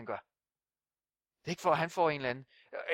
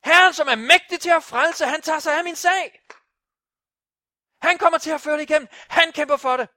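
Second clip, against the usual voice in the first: high, loud declaration of faith; full-force preaching shout; shouted proclamation; 11 semitones higher, 15 dB louder than usual. Acted. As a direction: shout it into the mirror with total conviction. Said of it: "Råb ind i spejlet".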